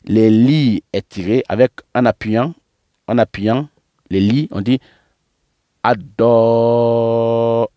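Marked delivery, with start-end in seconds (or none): 5.8-7.7 "ado" with a long sustained "o"; level, drawn-out final vowel in "ado"; pressed long do. Shown as a demonstration. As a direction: lean on the "do" of "ado" and hold it. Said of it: "en appuyant sur le do".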